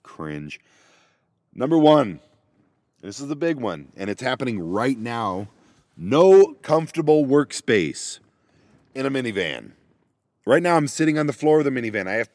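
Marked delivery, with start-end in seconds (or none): 0.0-0.6 viewers cringing. Retold or imitated. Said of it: "all of you out there are just like". 6.0-9.7 emphatic title. Acted. Announcing number one.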